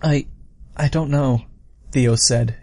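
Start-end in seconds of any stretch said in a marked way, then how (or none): none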